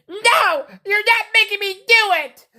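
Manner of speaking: high-pitched mock tantrum; bratty attitude. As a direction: imitate a student throwing a fit. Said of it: "When I heard her attitude".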